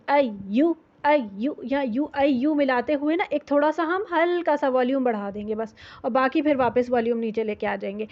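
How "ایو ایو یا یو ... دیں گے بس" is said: ordinary delivery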